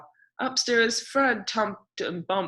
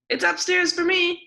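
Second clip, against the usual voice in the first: very high, loud happy yell; shouting; 10 semitones higher, 5 dB louder than usual. A narrator's reading. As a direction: yell it out with glee. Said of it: "yelled Ted".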